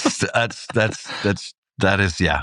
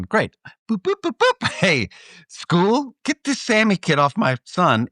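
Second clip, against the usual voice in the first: much higher mock mom voice, chirpy and brisk; mom taking charge; 10 semitones higher than usual. Son recalls mom who'd phone the school, mock high motherly voice. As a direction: play the mom dialing the school right away. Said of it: "My mom would have gone".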